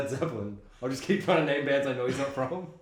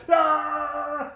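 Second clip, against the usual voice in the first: high, loud held-out mock war cry; chasing cannibals' yell; 12 semitones higher, 6 dB louder than usual. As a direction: imitate a yelling mob in pursuit. Imitating cannibals all yelling in chase.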